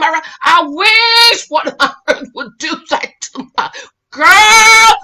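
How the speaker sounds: very loud high outburst; fired-up challenge. Real, not acted. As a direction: dare them, voice cracking. Daring friends, fired up.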